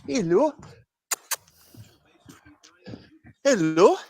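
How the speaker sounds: high sing-song pet talk. Real, not acted. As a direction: coo up high at the animals, each hello rising. Greeting animals in pet voice.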